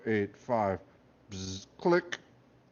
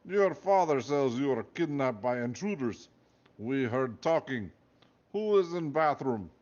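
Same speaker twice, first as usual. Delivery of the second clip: put-on guard questioning in a thick Russian accent; suspicious Russian guard accent; Russian guard demanding to know who is in the bathroom, in a thick accent; 3 semitones higher than usual. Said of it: "with a heavy Russian accent".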